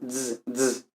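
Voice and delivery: Z said as ts; TS sound. Shown as a demonstration. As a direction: buzz the Z as a ts. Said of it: "sino como Z".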